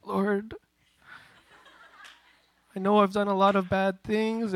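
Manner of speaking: acted-out penitent praying; mock desperate prayer. Acts desperate prayer in his room.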